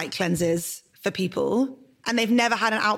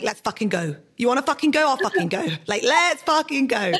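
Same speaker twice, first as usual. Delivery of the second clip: fiery mock challenge; 5 semitones higher than usual. Telling a podcast friend her fiery conflict style, mock combative. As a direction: throw down the gauntlet, fired up. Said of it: "My conflict style is like".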